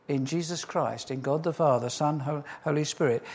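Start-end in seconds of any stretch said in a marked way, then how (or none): none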